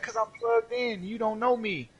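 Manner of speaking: smug brag. Retold or imitated. Bragging to salty coworker.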